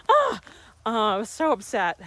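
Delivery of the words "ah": mock angry scream. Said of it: "I was like".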